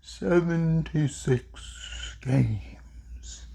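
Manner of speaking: slow, hushed delivery, fading to a whisper; slow, deliberate. Real, not acted. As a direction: draw it out softly, word by word. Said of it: "I'm gonna say it slowly".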